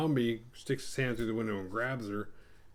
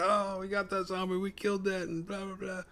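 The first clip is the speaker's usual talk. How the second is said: mock laughing boast; 10 semitones higher than usual. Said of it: "they're laughing".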